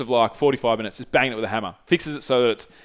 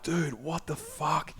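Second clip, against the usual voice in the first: soft, drawn-out retold disbelief; stunned reaction; 3 semitones higher, 11 dB quieter, much slower than usual. Reacting to his friend's severe burns, stunned and hushed. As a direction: stretch it out slowly and quietly, appalled.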